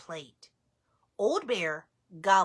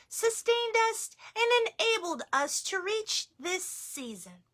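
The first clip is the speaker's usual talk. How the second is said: a higher grandma voice; grandmother voice; 8 semitones higher than usual. Acted. Baba Brena praising the bear's blessing, higher voice. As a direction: play a gentle praising grandmother.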